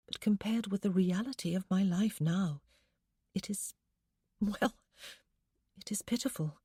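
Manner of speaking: hint of a contented lady in love; lightly voiced young wife. A narrator's reading. Sophia voiced by narrator.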